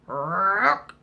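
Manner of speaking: low, rising croak; single long rolled croak; frog croak. Acted. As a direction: imitate a frog's croak. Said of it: "it was the frog".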